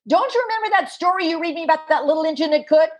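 put-on high, fast, insistent little boy voice; small child urging his injured mom; five-year-old boy. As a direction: play the exasperated kid pleading with mom. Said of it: "this five-year-old puts both hands on his hips".

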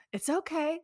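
usual speaking voice

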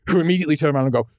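normal talking voice

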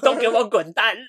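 loud, scornful "get out" shout; mock dismissal. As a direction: shout them out the door with scorn. Mocking the other faction's dismissal.

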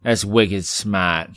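slow, drawn-out Boston "smaht"; mock Boston, dropped R; Boston accent. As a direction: imitate a Boston accent, stretching the words. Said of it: "in his Boston accent".

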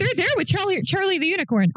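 a slip into Boston accent. Real, not acted. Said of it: "Oh, my Boston accent".

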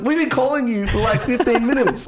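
high-pitched, hammed-up disbelief; mock exasperation. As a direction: imitate fed-up staff, voice shooting up. Exasperated staff answer customer, retold.

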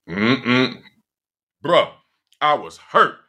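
usual speaking voice

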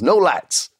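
high, emphatic put-on refusal; Bruce Pritchard. Mentor refusing the request for lights, emphatic. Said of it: "Bruce Pritchard comes back up".

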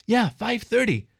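high, slow, falling confirmation; emphatic confirmation. A narrator's reading. Fox confirms the outrageous hour.

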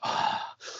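long breathy exhale, imitated; decompressing sigh. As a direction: let out a mock calming-down sigh.

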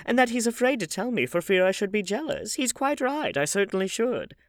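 high, animated society lady; crying out. A narrator's reading. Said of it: "cried Lady Narborough".

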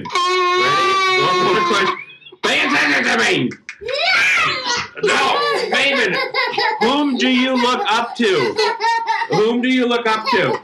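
high, loud laughing call; laughing shout. Real, not acted. Mother laughing over yelling kid.

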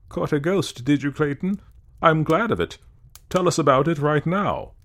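high, loud, eager demand; shouted. A narrator's reading. Evans demands Clayton's ghost story at once, shouting. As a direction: call it out loudly, pitched well up.

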